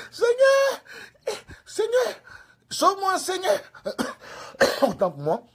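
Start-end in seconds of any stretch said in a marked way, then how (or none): 0.1-3.6 very high mock wail; panicked pleading. Bitten evangelist begging the Lord to save him, mock wail. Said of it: "L'évangile maintenant dit". 3.6-4.8 throaty cough; real cough. Real, not acted. Preacher interrupting his own imitation, real cough.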